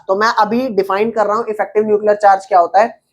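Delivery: ordinary delivery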